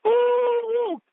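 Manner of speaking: very high, sustained whooping "ooh"; high, delighted hoot; excited "ooh". Real, not acted. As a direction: whoop as if the quote hit home. Overwhelmed by the quote.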